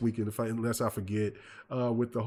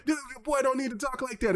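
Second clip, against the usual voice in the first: bystander parent; 10 semitones higher than usual. Retold or imitated.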